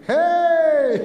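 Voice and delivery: loud, high-pitched yell; big high "hey" shout; calling out. Retold or imitated. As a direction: holler it at someone across the street. Yelling at girls from cop car.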